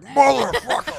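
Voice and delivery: put-on cursing old man. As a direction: imitate an old man swearing as he hammers. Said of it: "an old man in the background hammering".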